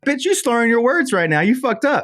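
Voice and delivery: high put-on scold; high teasing voice calling out a drunk friend; mock scolding. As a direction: tease her for slurring in a high voice.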